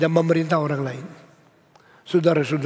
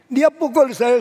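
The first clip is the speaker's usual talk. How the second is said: a sudden higher, louder protest; indignant outburst; 7 semitones higher, 4 dB louder than usual. Acted. A preacher voicing a believer's complaint to God, raised and indignant. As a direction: complain to God that he hit you.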